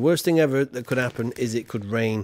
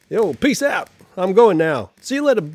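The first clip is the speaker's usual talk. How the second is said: put-on loud, high American-accented goodbye; hammed-up American accent, signing off loudly; American accent as Guy Fawkes; 5 semitones higher, 8 dB louder than usual. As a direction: shout a cocky American farewell. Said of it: "Why am I doing an American accent for Guy Fawkes?".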